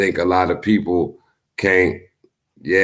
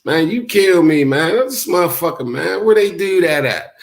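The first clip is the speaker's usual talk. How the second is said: mock exasperation; 8 semitones higher, 4 dB louder than usual. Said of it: "somebody say something to you about they mad".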